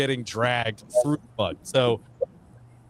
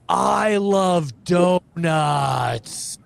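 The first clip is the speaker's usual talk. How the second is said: slow, high, drawn-out drill call; company commander's call; 5 semitones higher, 3 dB louder, much slower than usual. Retold or imitated. Company commander feeding a recruit his line, long drawn-out call. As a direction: stretch every word like a drill sergeant. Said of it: "And he goes".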